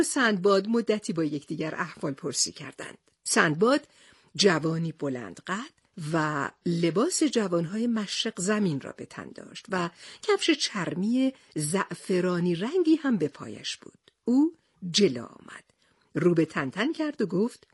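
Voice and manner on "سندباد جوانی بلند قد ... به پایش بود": plain reading voice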